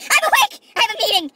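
high, loud startled wake-up; retelling a panicked wake-up; mock panic. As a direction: jolt awake in alarm. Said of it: "I woke up and I'm like".

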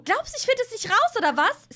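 high, loud shrieking accusation; furious yell. Acted. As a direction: scream it at your sister. Sisters' acted quarrel.

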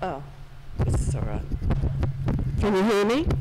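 normal talking voice

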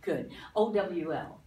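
ordinary delivery

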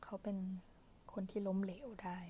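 usual speaking voice

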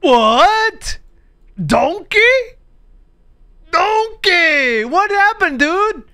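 high, loud shocked yelling; shocked outcry. Real, not acted. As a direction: cry out in disbelief at the sight. Shocked at Donkey's fate.

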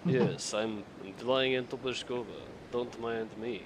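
slow, firm put-on Russian accent; mock Russian accent. Telling his teammate where they fly, in a slow mock Russian accent. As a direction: give orders in a lightly done Russian accent. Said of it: "we'll call that a Russian accent".

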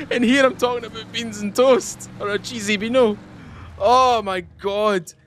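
high-pitched, rattled exclaiming; shaken relief. Real, not acted. Player talking of beans after a scare, rattled and high. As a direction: still reeling from the scare, voice pushed up high.

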